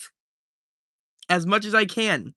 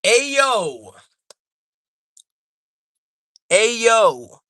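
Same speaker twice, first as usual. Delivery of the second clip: louder hype shout; hype call; 4 semitones higher, 5 dB louder than usual. Real, not acted. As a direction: call out to the chat, pumped. Hyping up a new member.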